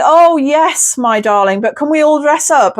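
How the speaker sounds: high, playful camp voice, lightly done; a fond, flouncy "oh yes my darling"; lightly voiced camp gent asking to dress up; camp John Inman. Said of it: "You're saying".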